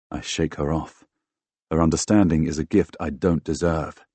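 ordinary delivery

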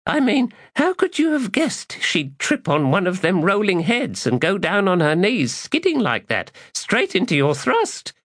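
high, teasing woman's voice; mocking woman voice. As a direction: needle him with high mock praise. Woman mocks his luck.

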